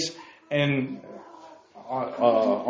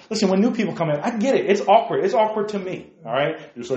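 ordinary talk

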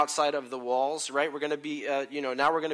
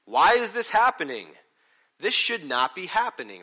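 loud, higher, disbelieving cry; exasperated why; 5 semitones higher, 11 dB louder than usual. Retold or imitated. A suffering believer asking God why, loud and exasperated. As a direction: throw the question at the sky. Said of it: "We go".

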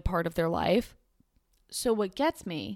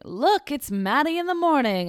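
higher, louder mock teacher voice; teasing teacher; 7 semitones higher, 5 dB louder than usual. Vlogger imitating teachers teasing her about Matty, high and loud. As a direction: tease like a middle school teacher, high and loud. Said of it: "teachers in, like, middle school were like".

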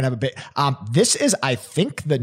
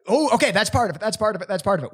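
upbeat, higher encouraging tone; encouraging host voice; 7 semitones higher, much faster than usual. Retold or imitated. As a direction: cheer them on like a game host. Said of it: "It's like".

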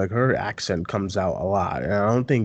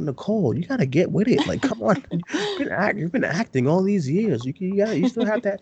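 mock scolding; 10 semitones higher than usual. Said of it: "I'm like".

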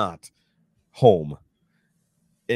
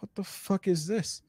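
high, softened retold disbelief; hushed bewilderment; 12 semitones higher, 7 dB quieter than usual. Dreamer hits his leg on unknown furniture, softly baffled. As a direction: let the pitch jump but keep it soft. Looks round an unfamiliar room.

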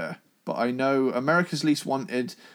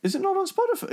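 shocked, much higher question; surprised; much faster than usual. Real, not acted. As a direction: ask it in disbelief.

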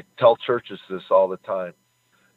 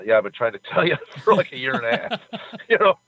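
laughing through the words, pitch jumping higher; laughing quote; 10 semitones higher, faster than usual. Retold or imitated. Friend laughing as he answers the sobbing caller, amused. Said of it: "And he said".

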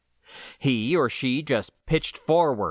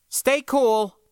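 higher, louder calling voice; 13 semitones higher, 5 dB louder than usual. Acted. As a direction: call through the armor. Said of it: "I called to the soldier inside".

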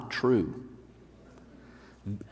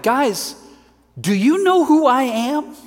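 Peter, pitched high and pleading, asking the crowd; a much higher voice, as an astonished Peter; Peter speaking; 11 semitones higher, 4 dB louder, slower than usual. Acted. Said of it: "and he's like".

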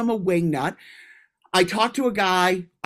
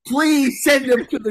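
higher, louder exasperated plea to get rid of a player; mock group plea; 8 semitones higher, 5 dB louder than usual. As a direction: ham up a fed-up teammate begging. Quoting teammates wanting someone gone.

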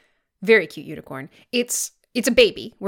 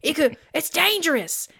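higher-pitched mock alarm; scared cat; 7 semitones higher than usual. Scared cat to the baby unicorn, mock-frightened. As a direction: overplay a cat's fear of a horn. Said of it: "make fun of him for being scared".

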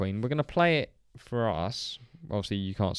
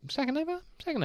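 squeaky high voice; 4 dB quieter, much faster than usual. Acted. Playful repeat in intro.

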